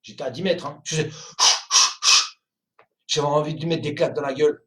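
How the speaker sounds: ordinary delivery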